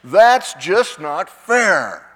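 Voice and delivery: loud, slow cry of protest; acted scream. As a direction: scream it out word by word, drawn out. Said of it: "something inside of all of us screams".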